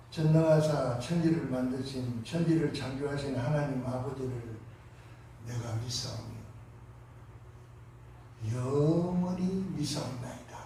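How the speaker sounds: hushed, murmured creed; quiet confession. Retold or imitated. Preacher retelling his hospital-bed creed, hushed. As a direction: recite it softly to yourself. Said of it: "고백이 터져 나오는 거예요".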